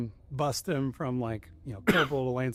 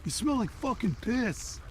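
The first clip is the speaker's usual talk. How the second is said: higher, drawn-out disgusted yell; disgusted outburst; 6 semitones higher, slower than usual. Retold or imitated. Josh yelling at the drunk Marine in the cell, disgusted. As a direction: bark it out at the smelly drunk.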